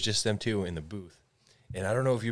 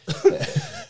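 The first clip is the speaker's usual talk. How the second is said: high laugh; 6 dB louder than usual. Real, not acted. Laughing after the story.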